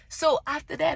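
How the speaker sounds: ordinary talk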